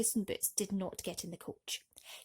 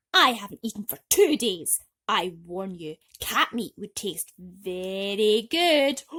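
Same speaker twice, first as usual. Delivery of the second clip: angry threat; 10 dB louder than usual. Acted. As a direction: warn the cat, hungry and cross. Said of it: "snapped Jack".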